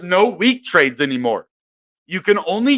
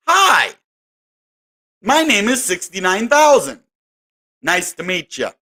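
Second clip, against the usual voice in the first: price personified; 3 semitones higher than usual. Pretend. Price introducing itself.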